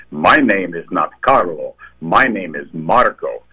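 put-on slow, emphatic Italian accent; thick Italian accent. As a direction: imitate an Italian correcting someone, word by word. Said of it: "in a very thick Italian accent".

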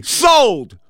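loud, high burst of triumph; triumphant exclamation. Real, not acted. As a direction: shout it like the deal just closed. Closing the ticket sale.

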